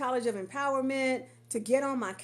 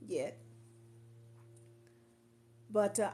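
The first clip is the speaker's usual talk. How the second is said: ordinary talk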